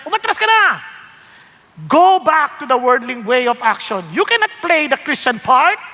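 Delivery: high, taunting voice of cowardice. Acted. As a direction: taunt the believer to retreat. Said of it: "sinasabi niya". (mapanuksong boses ng kaduwagan)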